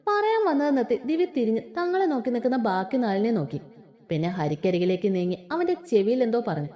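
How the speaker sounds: ordinary delivery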